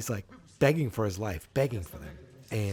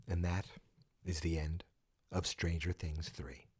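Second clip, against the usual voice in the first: low, quiet, slowed closing line with a dramatic fade; hushed fake ending; 5 semitones lower, 9 dB quieter than usual. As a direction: let it fall away softly as if the show is over. Mock final sign-off before twist.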